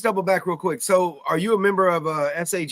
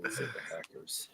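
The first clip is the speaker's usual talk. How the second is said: soft unvoiced whisper; 10 semitones lower, 16 dB quieter than usual. Real, not acted. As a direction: whisper it as a secret aside. Whispered aside.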